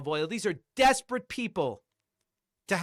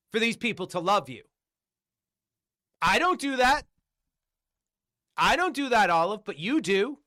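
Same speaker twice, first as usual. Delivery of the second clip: usual speaking voice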